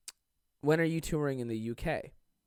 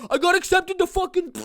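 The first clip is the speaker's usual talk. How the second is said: much higher, louder boastful squeal; bragging classmate; 9 dB louder than usual. Retold or imitated. Mocking classmates bragging about college acceptances. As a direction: squeal it like a show-off. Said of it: "all these fucking guys and girls were like".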